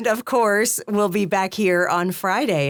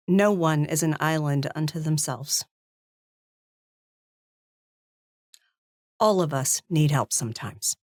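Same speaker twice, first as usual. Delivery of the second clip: solemn heartfelt outro; 2 semitones lower than usual. Real, not acted. Wife closing the stream on mental health, solemn and measured.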